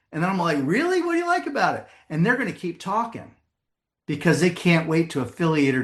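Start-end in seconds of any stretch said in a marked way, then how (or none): 0.6-1.9 very high-pitched curious follow-up; intrigued surprise. Acted. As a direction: play up keen interest to draw someone out. Acts eager interest in driver.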